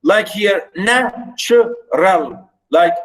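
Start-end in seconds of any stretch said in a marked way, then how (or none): 0.8-2.5 "natural" slowly split into NA, tu, ral; stretched, separate beats on "natural", falling at the end; syllable by syllable. Shown as a demonstration. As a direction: dissect "natural" for the class. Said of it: "high and then we go down".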